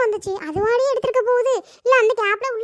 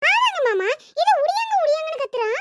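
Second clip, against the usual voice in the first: panicked; 6 semitones higher than usual. Acted. Panicked girl urging help.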